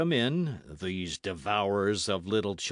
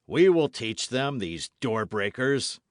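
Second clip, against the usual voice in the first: emphatic, slowing menace; loud threat; 3 semitones higher, 7 dB louder than usual. Acted. Athos behind door to his friend, loud threat. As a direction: promise them a lesson at full voice.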